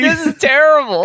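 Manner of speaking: very high, wailing complaint; anguished dismay. Real, not acted. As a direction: cry out in comic agony at awful writing. Heckling the terrible AI script.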